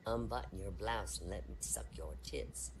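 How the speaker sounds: low, soft wolf growl; low sly wolf voice; big bad wolf.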